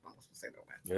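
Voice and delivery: whispered aside. Real, not acted. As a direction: drop to a whisper.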